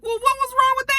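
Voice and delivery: mock-indignant high-voiced Oliver asking what's wrong; high defensive Oliver. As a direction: squeak it out defensively. Voicing Oliver's defensive question.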